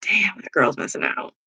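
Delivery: hushed, breathy whisper of pity; whispered joke to the chat; whispered aside. Real, not acted. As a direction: drop to a whisper, as if sharing a secret. Aside to viewers.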